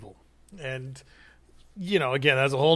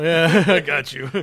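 louder, slow laughing reply; 8 dB louder, slower than usual. Real, not acted. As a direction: laugh it out, amused.

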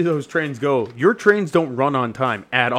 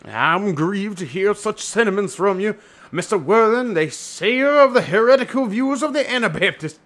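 hammed-up clergyman, climbing higher in pitch; aggrieved Doctor Chasuble; 5 semitones higher than usual. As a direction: lament it in a put-on, high reverend voice.

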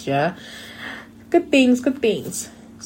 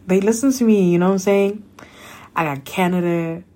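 ordinary talk, nothing marked

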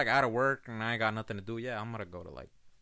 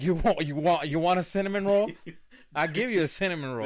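taunting; 5 semitones higher, 5 dB louder than usual. Retold or imitated. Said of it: "and I'm like".

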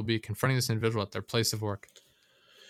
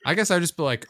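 ordinary talk, nothing marked

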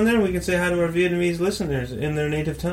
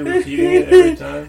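high, laughing disbelief; amused, giggling question; 13 semitones higher, 7 dB louder than usual. Real, not acted. Laughing at the robot voice.

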